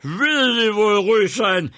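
high, loud booming order; thundering voice. Acted. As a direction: thunder it at her. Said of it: "mit donnernder Stimme".

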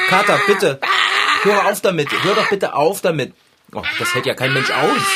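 laut flehend, beim ersten Wort viel höher. Acted. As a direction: verzweifelt betteln, dass es aufhört. Radschrauber fleht den tobenden Kater an. (Radschrauber fleht den Kater laut und gequält an)

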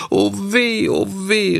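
high wailing cry; Bengele. A narrator's reading. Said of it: "rief Bengele". (verzweifelter, hoher Klageruf)

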